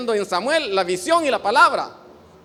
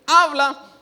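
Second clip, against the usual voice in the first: loud, high, falling "Habla"; conditional willingness; 4 semitones higher, 6 dB louder than usual. Shown as a demonstration. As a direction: say "Habla" loudly, as an over-eager offer. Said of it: "No decir". («Habla» fuerte, de obediencia condicionada)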